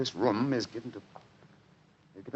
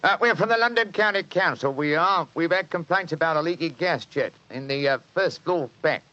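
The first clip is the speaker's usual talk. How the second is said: high Cockney workman voice, louder; Cockney disguise; 7 semitones higher, 3 dB louder than usual. Acted. Disguised Holmes fibs to the landlady, Cockney accent. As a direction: bluff the landlady as a Cockney council man. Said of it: "My Cockney accent".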